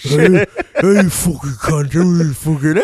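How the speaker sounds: lightly British tough-guy insult; mock British thug. Trejo cursing out the director, mock British accent. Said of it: "Why does he sound British?".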